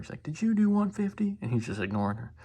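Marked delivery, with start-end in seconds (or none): 0.2-1.4 high-pitched imitation of a woman calling out; mock yelling lady. Lady yelling from the car at the dude, mock yell. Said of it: "she's yelling from the car".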